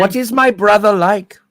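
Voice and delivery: put-on, high-pitched villain voice; Blofeld impression. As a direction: play the villain probing, pitched well up. Jokes as Blofeld to psychiatrist.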